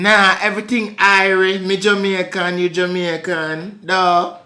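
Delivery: coaxing Jamaican patois; Jamaican accent. Pretend. Claiming to be Jamaican, in a Jamaican accent. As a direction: protest in a put-on Jamaican accent. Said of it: "You just doing the accent".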